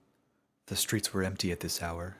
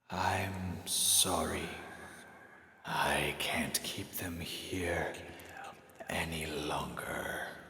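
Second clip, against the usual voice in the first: put-on hushed and weary, like something old from the earth; slow whispered otherworldly voice; ancient spirit voice; 2 semitones lower, 4 dB quieter, much slower than usual. Toll speaks again.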